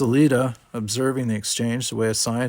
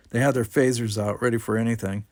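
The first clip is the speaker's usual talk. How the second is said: ordinary delivery, nothing marked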